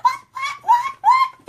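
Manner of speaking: very high, squeaky stunned repeats; stunned disbelief. Retold or imitated. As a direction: shriek it in happy disbelief. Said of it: "and I'm just like".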